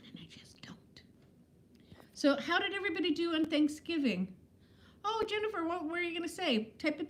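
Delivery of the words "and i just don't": whispered aside; whispering. Real, not acted. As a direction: breathe it out under your breath. Admits undone chores aside.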